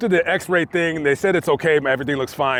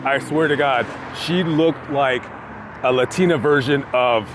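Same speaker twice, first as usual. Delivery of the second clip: normal talking voice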